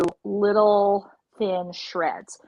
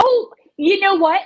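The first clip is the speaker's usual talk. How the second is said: surprised; 10 semitones higher, 9 dB louder than usual. Real, not acted. Realising the forgotten shallots.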